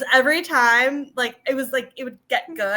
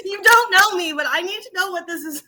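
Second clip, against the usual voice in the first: higher and louder, laughing plea; excited mock plea; 8 semitones higher, 5 dB louder than usual. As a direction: beg the character, half laughing. Pleading with character, laughing.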